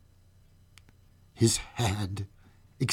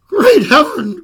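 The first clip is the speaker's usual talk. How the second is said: loud, high startled cry; cried out; 12 semitones higher, 11 dB louder than usual. Acted. Police officer seeing the corpse, crying out in shock. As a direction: shout it out in shock. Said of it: "cried he".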